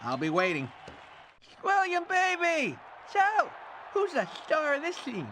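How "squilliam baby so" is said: high, stretched-out greeting; showbiz hail. Acted. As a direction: call out like a schmoozing producer. Producer hails his talent.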